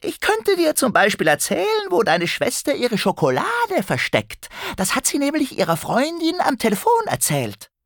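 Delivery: very high, piping goblin voice; phone goblin. A narrator's reading. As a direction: tempt him with gossip in a goblin squeak. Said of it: "der Handykobold redete schon weiter".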